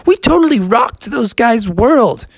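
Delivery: put-on very high triumphant kid voice; gloating falsetto celebration; Froggy. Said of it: "he was like".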